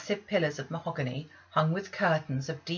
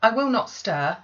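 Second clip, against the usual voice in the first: urgent promise; 6 semitones higher, 9 dB louder than usual. Acted. Girl promising.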